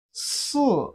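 "si" starting high, dropping sharply; long s, then let "si" fall from high. Shown as a demonstration. Teacher models Chinese four for learners, falling "si". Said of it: "is the falling tone".

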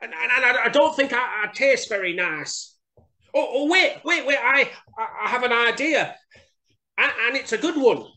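high, stammering mouse, thinking fast; pleading little mouse. A narrator's reading. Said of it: "said Little Mouse".

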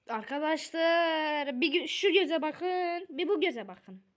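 loud, high, stretched-out call to viewers; drawn-out calling. Real, not acted. Child showing viewers the drawn eyes, drawn-out calling. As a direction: draw the words out, high and wavering.

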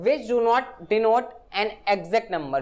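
very slow, word-by-word definition read; slow dictation. Shown as a demonstration. Teacher dictating a grammar definition to students, very slow. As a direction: spell it out slowly for students to copy.

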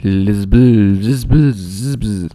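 "Lispel" with the s and z sounds drawn out; exaggerated lisp. Gamer playing with the word "lispel", exaggerated mock lisp. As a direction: stretch and slur each "lispel" into a mock lisp. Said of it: "Der lispelt".

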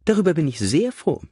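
high, chirpy lady's voice; brisk cheerful lady. A narrator's reading. Mother showing her son the figures, brightly high. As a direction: hand it over brightly, lilting high.

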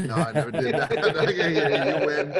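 laughing through the words; laughing reply. Retold or imitated. Son answers his dad at dinner, laughing. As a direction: concede to Dad while cracking up.

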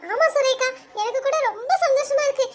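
ordinary delivery

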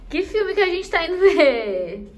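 high, loud, drawn-out teasing question; excited. Real, not acted. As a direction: build suspense, slow and loud. Teasing viewers about the movie.

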